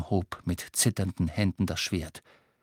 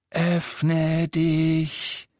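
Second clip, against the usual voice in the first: snake-like hiss, higher; 6 semitones higher, 4 dB louder than usual. Acted. Harry opening the locket in snake tongue, hissing. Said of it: "wie ein Zischen und ein Fauchen".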